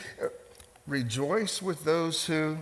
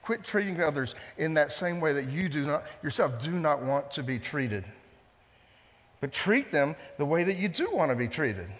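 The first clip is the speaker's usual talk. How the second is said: normal talking voice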